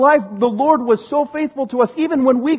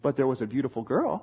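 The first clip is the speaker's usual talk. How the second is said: very low, soft, quick aside; hushed aside; 12 semitones lower, 11 dB quieter, faster than usual. Real, not acted. Speaker hints at his future wife, hushed aside. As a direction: slip it in under your breath.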